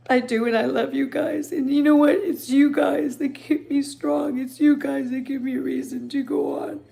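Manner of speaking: real happy tears, voice pitched high; choked up with thanks, voice climbing high; tearful gratitude. Real, not acted. Thanking the viewers who keep her strong, tearful. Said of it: "I'm crying 'cause I'm grateful".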